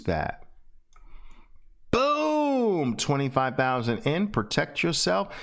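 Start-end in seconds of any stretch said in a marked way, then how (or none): 1.9-3.0 long cry of boom sliding high then falling; high drawn-out boom. Shown as a demonstration. Teacher making his favorite trade noise, long and high. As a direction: let the boom ring out like a cry. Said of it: "there's that sound again".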